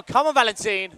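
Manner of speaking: loud, high cheer; cheering shout. Real, not acted. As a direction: urge him on loudly. Cheering rider on.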